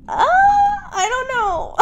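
put-on high, small, hesitant child voice; timid girl voice. Narrator imitates the girl answering the vampires, high and unsure. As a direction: squeak it out unsure, like a scared little girl. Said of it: "She's like".

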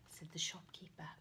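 fast, soft unvoiced aside; whispered aside. A narrator's reading. Narrator slipping in the tag, whispered. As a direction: breathe the tag quickly.

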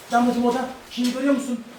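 real urgent call to a helper; calling out instructions. Real, not acted. Directing children while pitching the tent.

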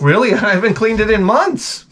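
high, laughing through the words; laughing friend. Retold or imitated. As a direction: laugh it off, amazed anyone would ask.

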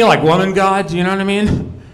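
ordinary talk, nothing marked

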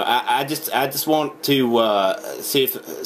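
ordinary talk, nothing marked

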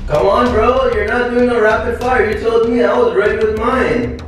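whining. Real, not acted. Complaining while freezing.

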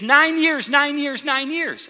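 high, insistent repeats, imitating an overwhelmed woman; mock weeping cry, pitched high without real sobs; retold sobbing. Weeping woman throws her arms around the pastor, high imitated cry. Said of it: "She's weeping and sobbing".

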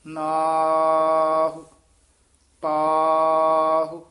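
ਨਾਹ and ਪਾਹੁ stretched very long and loud; loud, very slow drill of ਨਾਹ, ਪਾਹੁ; drawn-out practice words. Shown as a demonstration. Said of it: "ਇਸ ਤਰ੍ਹਾਂ ਉਚਾਰਨ ਕਰਨਾ ਹੈ।".

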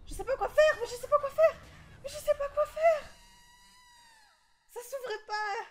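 high, loud panicked cries, half laughing; panicked shout. Real, not acted. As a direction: yell in real panic, voice shooting up. Stuck in horror game, panicking.

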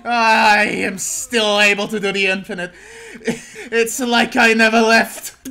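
loud, drawn-out boast breaking with laughter; swelling, tearful-sounding elation; gleeful triumph. Real, not acted. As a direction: crow it slowly, nearly laughing. Celebrating pulling off the infinite.

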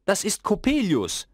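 high, tense alarmed cry; mother recognising the visitor in fear; frightened mother. A narrator's reading. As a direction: gasp out the name in fear. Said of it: "wurde ganz blass".

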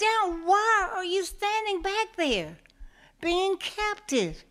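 high, loud, scornful big cat; tiger's taunting voice. Acted. Tiger mocks captive water buffalo, high and loud. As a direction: goad him like a sneering tiger.

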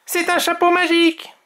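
high-pitched excited little character voice; exclaiming Tom. A narrator's reading. Tom discovers a magic hat, high excited exclamation. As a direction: exclaim it high, full of wonder. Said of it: "s'exclame Tom".

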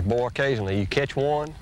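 usual speaking voice

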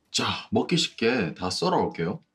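ordinary talk, nothing marked

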